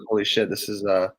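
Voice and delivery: ordinary delivery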